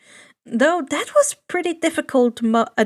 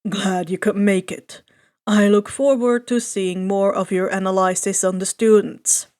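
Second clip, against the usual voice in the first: lower, level teacher voice; tired teacher; 5 semitones lower than usual. A narrator's reading. Homeroom teacher greeting the young analyst, low and level. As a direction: keep it low and unbothered.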